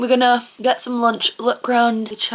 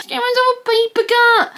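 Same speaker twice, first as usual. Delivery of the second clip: high-pitched complaint through the sting; pained squeal; 13 semitones higher, 4 dB louder than usual. Real, not acted. Vlogger who just got a paper cut, pained squeal. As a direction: squeal it, much higher than usual.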